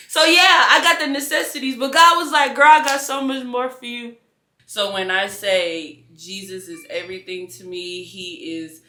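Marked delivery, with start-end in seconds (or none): 0.1-4.2 high and loud, fast rush of praise; fervent testimony. Real, not acted. Believer shouting what God promised her. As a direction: lift the voice like a preacher on fire.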